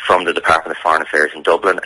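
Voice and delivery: usual speaking voice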